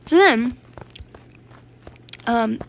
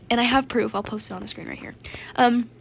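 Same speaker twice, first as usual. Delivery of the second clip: ordinary talk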